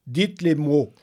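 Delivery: high, loud strange command. Acted. The creature in the dark demands the words, high and loud. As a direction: order it from the darkness. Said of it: "parla sur un ton plus élevé".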